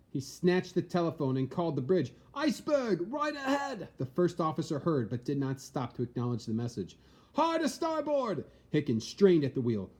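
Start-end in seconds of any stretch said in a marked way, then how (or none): 2.3-3.9 much higher, shouted warning; urgent shout. Acted. Lookout shouts warning to bridge. 7.3-8.4 much higher, slow, commanding shout; first officer ordering the helm hard over. Acted.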